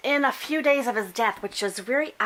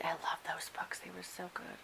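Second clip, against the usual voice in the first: soft fond whisper; 4 semitones lower, 14 dB quieter than usual. Real, not acted. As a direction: whisper it like a secret. Fond hushed aside on books.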